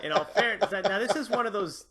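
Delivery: loud, laughing through the words. Real, not acted. Laughing while conceding a mistake.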